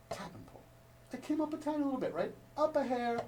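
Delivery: small, soft voice rising high; tiny and hushed. Shown as a demonstration. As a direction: go tiny and delicate with the voice. Demonstrates a tiny stick lift.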